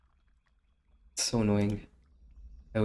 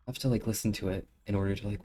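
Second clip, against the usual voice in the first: ordinary talk, nothing marked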